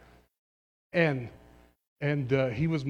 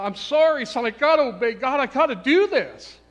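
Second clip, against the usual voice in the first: loud, higher, pleading apology; emotional plea; 13 semitones higher, 12 dB louder than usual. Retold or imitated. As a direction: beg your son's forgiveness out loud. Retelling tearful restaurant confrontation.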